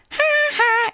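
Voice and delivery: very high sing-song "Ha, ha"; sing-songy trigger. Shown as a demonstration. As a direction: squeak it up high like a cartoon kid. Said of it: "sing-songy things as good little triggers".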